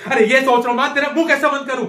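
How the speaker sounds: fed-up burst of anger; exasperated outburst. Acted. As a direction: explode at the nagging question. Exploding at the repeated question.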